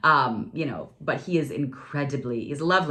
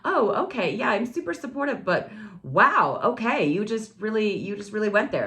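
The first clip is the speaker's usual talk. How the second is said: lightly voiced ex; 6 semitones higher than usual. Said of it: "he was like".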